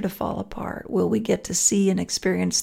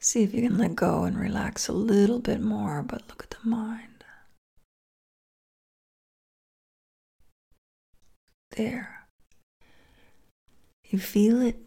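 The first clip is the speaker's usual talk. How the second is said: guided meditation. Acted.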